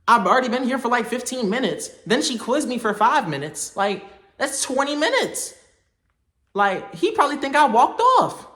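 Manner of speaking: fretting about dad waiting, pitch up; retold panic. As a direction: voice climbing in mock panic. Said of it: "I'm like".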